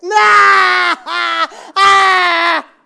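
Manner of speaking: high, very loud mock shrieks of laughter; loud shrieking laugh. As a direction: imitate a child cackling wildly. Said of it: "laughing to herself out loud, like, really hard".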